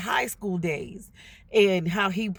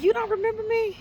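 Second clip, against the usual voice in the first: surprised question; 13 semitones higher, faster than usual. Retold or imitated. Asking old schoolmate surprised.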